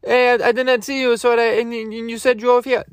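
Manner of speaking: high-pitched, stammering mock apology; apologetic driver.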